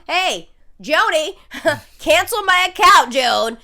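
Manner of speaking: loud sarcastic call-out, slow start; mock taunt. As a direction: jeer at the moderator loudly. Mocking the moderator by name.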